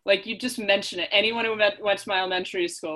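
usual speaking voice

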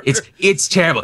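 ordinary talk

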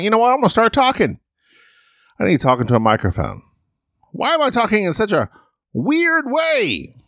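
odd high sing-song voice, rushed; weird voice. Real, not acted. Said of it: "let me get down to a normal way of talking here".

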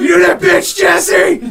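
shouted rocker growl, high and loud; growling rock star. Retold or imitated. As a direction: snarl it at the crowd. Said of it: "just growling it".

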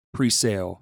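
ordinary delivery

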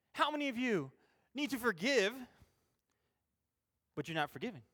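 ordinary delivery

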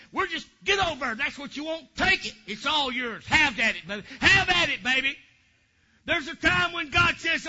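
loud, driving preacher voicing God's rebuke; preaching at full force. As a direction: thunder it out like God dismissing the scoffers.

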